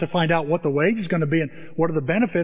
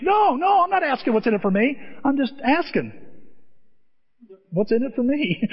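high-pitched mock protest; hammed-up denial in a raised pitch; defensive protest; 8 semitones higher than usual. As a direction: protest too much, voice jumping up. Acting a defensive movie character's protest.

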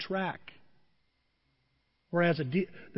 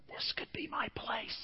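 breathless whisper to himself; hushed covetous whisper; 4 semitones higher, 14 dB quieter than usual. Retold or imitated. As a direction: whisper it like a secret wish. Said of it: "I'm walking around going".

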